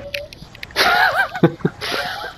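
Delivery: high-pitched giggling burst; high squealing laugh. Real, not acted. Laughs after friend scared.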